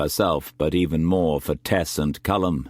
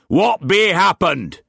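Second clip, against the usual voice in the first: angry demand; 13 semitones higher, 6 dB louder than usual. A narrator's reading. Angry captain demands answers.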